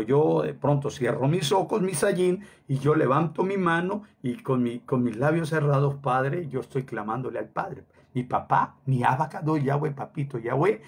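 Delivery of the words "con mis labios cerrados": ordinary delivery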